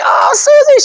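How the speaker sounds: high delighted cry of her name; light up calling out to her. Retold or imitated. Spotting a friend at dinner, delighted high call.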